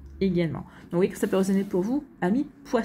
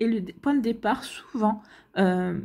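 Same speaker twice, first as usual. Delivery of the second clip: ordinary talk, nothing marked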